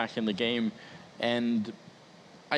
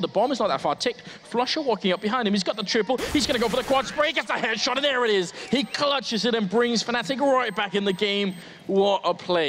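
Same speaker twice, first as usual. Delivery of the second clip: excited esports caster; 8 semitones higher than usual. Real, not acted. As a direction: build to a roaring clutch call. Caster calls the clutch play live.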